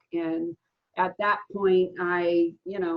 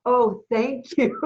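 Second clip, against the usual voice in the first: dry mock gratitude, higher, louder and slow; sarcastic thank-you to a cruel remark; 5 semitones higher, 6 dB louder, slower than usual. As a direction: throw the thanks back as a joke. Mock reply to the corpse insult.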